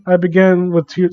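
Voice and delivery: plain reading voice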